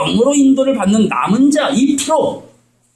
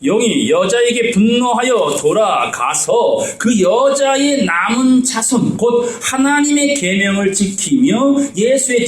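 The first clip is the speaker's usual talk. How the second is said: slow, raised solemn reading of the verse; scripture reading; 5 semitones higher, slower than usual. Acted. Preacher reading scripture to the congregation, slow and solemn. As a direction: read it out slowly like a pulpit lesson.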